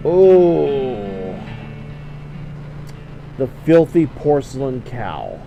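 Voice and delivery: ordinary delivery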